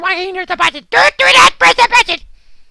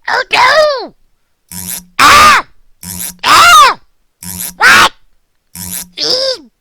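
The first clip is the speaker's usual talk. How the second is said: panicked cry; 4 semitones lower, 4 dB louder, much slower than usual. Acted. Dog crying out at a copy of himself. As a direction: scream in alarm. Sees another dog appear.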